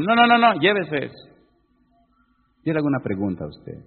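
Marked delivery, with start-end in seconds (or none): 0.0-1.3 insistent refusal. Retold or imitated. Woman insists he take card.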